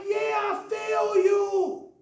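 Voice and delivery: slow, drawn-out agreement; slow agreeing. Retold or imitated. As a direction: stretch it out, nodding along. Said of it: "my boy was like".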